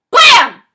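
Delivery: explosive high shout of Blam; loud gunshot blam. A narrator's reading. Narrator imitates the gun going off in a little room, explosive blam. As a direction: bang it out like a gunshot. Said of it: "the loudest thing I've ever heard".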